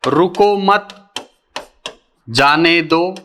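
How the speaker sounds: रुको मत said together, long pause, then जाने दो; pause after मत. Shown as a demonstration. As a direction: stop clearly after मत before saying जाने दो. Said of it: "मत शब्द के बाद". (रुको मत के बाद लंबा विराम, फिर जाने दो)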